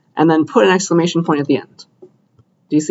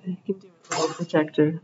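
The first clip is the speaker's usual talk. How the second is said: much softer, coaxing the projector along; 9 dB quieter than usual. Real, not acted. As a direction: urge the machine on under your breath. Coaxing a slow projector again.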